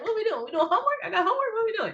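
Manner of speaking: high-pitched eager rapid questions; excited questions. Retold or imitated. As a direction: fire them off like a kid eager for homework. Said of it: "Like, I'm excited".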